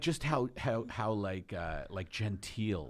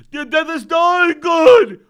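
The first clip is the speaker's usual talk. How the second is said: high, full-volume mock demand; hammed-up frantic shouting; 23 dB louder, slower than usual. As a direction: yell it like a crazed fan. Acted frantic craving for good stuff.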